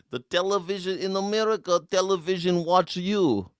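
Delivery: put-on singsong Russian-accented joke delivery; Yakov Smirnoff. Streamer improvising a joke about Canada, high Russian accent. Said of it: "a Yakov Smirnoff joke".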